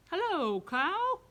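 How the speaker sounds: high, loud chirpy duck voice; bright, loud duck hello; cheery duck greeting. Acted. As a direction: greet the cow like an excited little duck. Duck greets animals in story.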